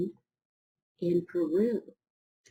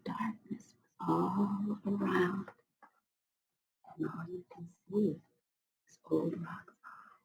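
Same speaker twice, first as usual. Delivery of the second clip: spooky hush. Real, not acted. Reader hushing children for the dark beginning of time. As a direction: make it dark and secret.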